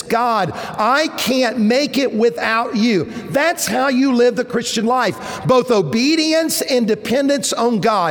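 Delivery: preacher urging obedience and dependence, fervent; fervent preaching. Acted. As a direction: lift the room toward dependence on God. Preaching climax, quoted prayer.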